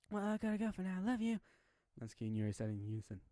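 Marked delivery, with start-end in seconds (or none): 0.1-1.4 put-on high, bright young child voice; little girl. Yuriki calling her old family, cheerful little girl. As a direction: play the small child on the phone.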